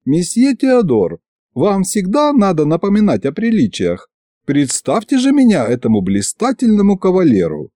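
put-on high-pitched, lightly reproachful vampire girl; reproachful young lady. A narrator's reading. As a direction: lift the voice into a prim scolding. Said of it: "с укоризной посмотрела".

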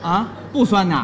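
slurred, mangled words; bone in mouth. Pretend. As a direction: talk as if chewing a bone. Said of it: "你这嘴里有骨头是怎么着".

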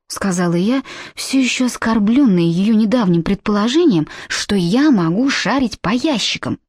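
usual speaking voice

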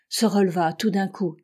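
plain reading voice

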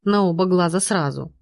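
plain reading voice

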